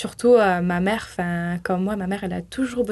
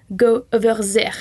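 put-on English in a thick French accent, louder; louder mock French-accented order; 6 dB louder than usual. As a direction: imitate a French woman's heavily accented English. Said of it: "Avec son accent très français".

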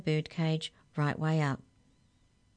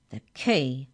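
firm, curt demand; 3 semitones lower, 4 dB louder than usual. A narrator's reading. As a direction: demand it like an order. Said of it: "demanded the Hex Checker".

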